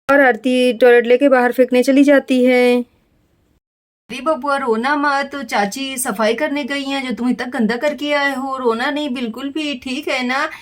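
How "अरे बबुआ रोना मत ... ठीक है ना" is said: soft, hushed comforting to a little one; soothing a baby. Acted. Aunt softly comforting the baby not to cry.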